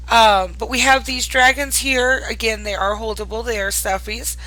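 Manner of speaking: normal talking voice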